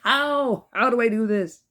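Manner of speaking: put-on baby's inner voice. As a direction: play a baffled infant wondering aloud.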